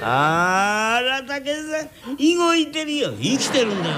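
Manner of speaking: put-on high, thrilled cry that the baby moves; excited wife. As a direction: squeal with delight at the baby. (赤ん坊が動いて高い声で喜ぶ女房)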